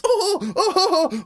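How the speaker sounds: high squealing mock panic; silly scattered shrieks; panicky kids. Kids running about like idiots, high squeals.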